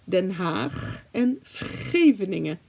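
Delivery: Den Haag with a throaty Dutch g, slowly; Dutch pronunciation. Shown as a demonstration. Teaching viewers Dutch city names. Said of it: "And The Hague, no".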